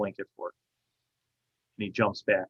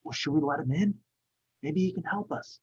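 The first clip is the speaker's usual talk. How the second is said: put-on hushed other child; 6 semitones higher, 6 dB quieter than usual. Second hidden child speaks.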